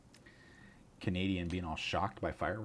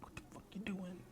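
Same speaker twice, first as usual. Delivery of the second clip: dog voice; 12 semitones higher than usual. Retold or imitated. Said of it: "the dog is like".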